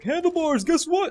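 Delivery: high, loud mock-eager call; imitating excited teammate. Teammate calling Handlebars with news, imitated excitement. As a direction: hail a friend with big news, playfully exaggerated. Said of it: "And Lucid Sky Diamond's like".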